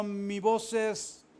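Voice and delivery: slow, deliberate, respectful answer; slow respectful reply to the king. Acted. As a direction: answer the king slowly and humbly. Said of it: "David respondió".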